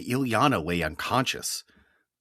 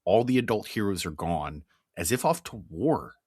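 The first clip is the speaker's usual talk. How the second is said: ordinary talk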